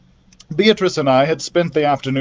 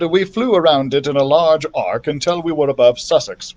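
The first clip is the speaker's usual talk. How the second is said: ordinary delivery, nothing marked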